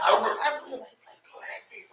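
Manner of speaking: hushed, hesitant imitation of whispering; softly whispered as if forbidden; mock whisper. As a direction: drop to a nervous mock whisper. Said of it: "she whispers it".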